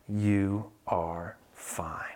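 slow soothing whisper; soft spa whisper. Real, not acted. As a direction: hush it like a lullaby. Calming child reading comfort book.

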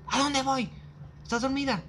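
high, pleading, rising with each line; insistent sleepwalker. Retold or imitated.

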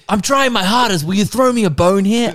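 high-pitched, hammed-up pleading; exasperated plea. Someone begging to be thrown a bone, exasperated. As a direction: plead loudly in mock desperation.

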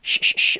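voiceless hissed "sh" held out; sh sound demo. Shown as a demonstration. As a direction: push air through for "sh". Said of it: "la quinta de nuestras letras clave". (sonido "sh" sin voz, exagerado)

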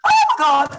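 high, loud retold "oh my God" squeal; starstruck outburst. Retold starstruck reaction to the compliment.

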